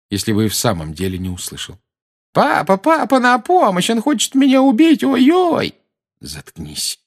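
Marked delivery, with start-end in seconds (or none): 2.3-5.7 mock cries for help. Dudley mock screaming for help. 6.2-7.0 low, soft menacing order; quiet threat. Acted. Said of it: "тихо произнёс Гарри".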